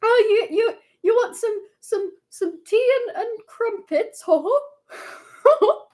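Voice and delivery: high, hammy fake British as an American would do it; Americanized British accent. Streamer Americanizing the British impression, high mock accent. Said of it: "I'm gonna, like, Americanize it".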